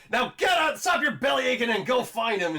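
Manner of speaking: high, loud mock boss yell ordering him out; retold yell. A crime boss yelling at his goon to get out. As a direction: bark the order like a gangster boss.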